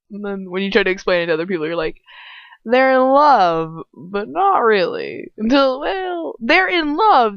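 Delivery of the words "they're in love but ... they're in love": drawn-out, higher, insistent; gushing explanation. Acted. Explaining the couple to other people, gushing. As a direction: stretch it out, high and emphatic.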